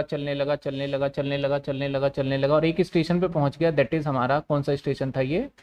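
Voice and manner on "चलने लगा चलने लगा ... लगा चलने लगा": softer, even, unbroken repeated loop; running repetition. Acted. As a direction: repeat it softly like a truck rolling on. Repeats phrase to mimic truck travelling. (लगातार, धीमी आवाज़ में एकसार दोहराव)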